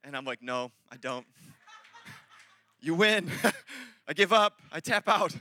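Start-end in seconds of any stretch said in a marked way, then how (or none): 0.4-1.3 low, soft admission of defeat; small, defeated reply; meek surrender. Retold or imitated. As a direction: back down quietly. Sheepishly giving in to his wife.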